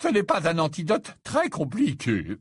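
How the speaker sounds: high, put-on professor voice; obliging old professor. Slughorn offering Harry an antidote, obliging and confident.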